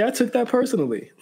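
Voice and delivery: lightly mimicking Jordan's famous line; Michael Jordan quote. Friend quoting Michael Jordan to explain himself, mock impression. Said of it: "As Michael Jordan would say".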